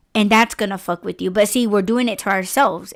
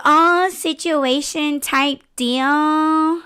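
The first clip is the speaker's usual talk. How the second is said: high, slow, stretched-out mimicry; imitated affected talker; 6 semitones higher, much slower than usual. Mocking how people pronounce words, exaggerated slow drawl. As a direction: drag each word out in mocking imitation. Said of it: "when people say".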